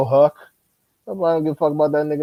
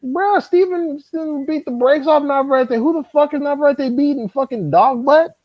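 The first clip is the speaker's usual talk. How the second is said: exasperated scoffing; 12 semitones higher than usual. Real, not acted. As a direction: drag it out high in disbelief. Mocking Navarrete's record.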